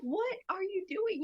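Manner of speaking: high, drawn-out shocked question; mother finding baby covered in poop, high and slow. Retold or imitated. Retold exasperated question to baby son.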